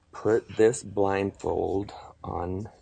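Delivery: usual speaking voice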